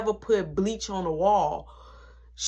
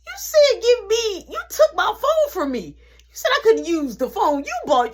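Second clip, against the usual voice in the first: inner rant; 10 semitones higher, 4 dB louder than usual. Retold or imitated. Mentally cussing out mom.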